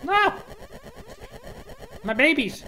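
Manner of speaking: high-pitched gamer wail; mock distress. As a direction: cry out for lost game pieces. Cries out over game loss.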